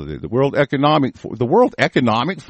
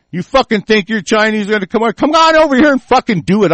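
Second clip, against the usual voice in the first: yelling a challenge, higher and louder; furious shout; 7 semitones higher, 4 dB louder than usual. Real, not acted. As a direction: bellow it as a dare to come fight. Angry challenge to Wang.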